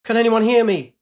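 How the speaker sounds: loud desperate shout into the comms. A narrator's reading. Pilot shouting into her comms for help, loud. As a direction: yell into a dying radio. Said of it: "She shouted at her comms".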